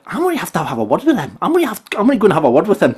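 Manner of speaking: fast, fussy dad grumbling; a hint of a fed-up dad psyching himself up; dad style. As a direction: mutter it like an annoyed dad. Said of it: "total dad style".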